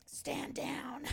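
whispered wolf voice; wolf impression. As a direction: hiss it like a wolf. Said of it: "her best wolf impression".

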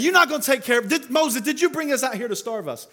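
hammed-up whine at Moses; mock indignant complaint. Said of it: "And yet they're saying".

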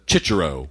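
"Cicero" said Italian-style with ch sounds, CHEE-cheh-ro; Italian pronunciation. Retold or imitated. Telling how an Italian friend says it, "Cicero" Italian-style with ch sounds. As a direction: say "Cicero" with a ch for each c. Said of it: "he pronounces it".

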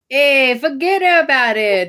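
loud mock New York accent. Podcaster mocking an actor's bad Irish accent, in broad New York accent. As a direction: lay on a hammed-up New Yorker's accent. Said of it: "hired someone from New York".

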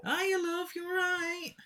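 very high, silly put-on voice; mock creature voice. Podcaster pretends the creature can talk, silly high voice. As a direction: squeak out a comic creature's greeting.

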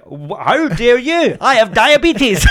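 very high, loud and drawn-out indignation; mock outrage. Offended diabetic answering the nurse, hammed-up outrage. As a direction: wail it as hammed-up offence, breaking into laughter.

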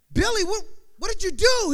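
loud, high, startled question; acted shock. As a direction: cry out in disbelief at your friend. Acted shock at friend's shooting.

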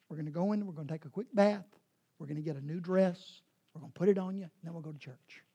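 soft, low, hushed mother's voice; gentle loving mom. Retold or imitated. Good mom gently tells her daughter, softly and low. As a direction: soothe a muddy child kindly.

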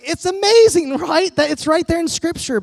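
high, loud delighted exclamation; triumphant. Retold or imitated. Preacher gloating to the healed kid, loud and delighted. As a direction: crow it with glee.

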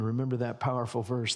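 ordinary delivery

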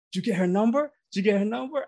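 imitating her excited asking; eager older lady. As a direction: usher quizzes him after the show, high and eager. Jamaican usher asks eagerly.

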